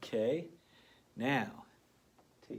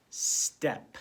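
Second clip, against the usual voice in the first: blended word; 3 semitones higher, 8 dB louder than usual. Shown as a demonstration. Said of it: "let's say the sounds".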